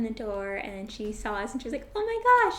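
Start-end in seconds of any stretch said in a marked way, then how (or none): 1.9-2.6 high, loud mock gasp of delight; imitated astonishment. Grandma spotting the couple at her door, imitated astonishment. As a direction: squeal it as an amazed grandma. Grandma's surprised reaction retold.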